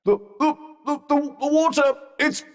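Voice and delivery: high, stuttering fluster; panicked stammer. Acted.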